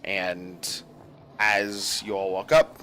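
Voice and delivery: ordinary delivery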